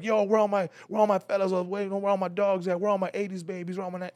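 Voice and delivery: high hype-man roll call; hype host callout. Retold or imitated. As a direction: keep the crowd hyped like an MC. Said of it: "concert hosting mode".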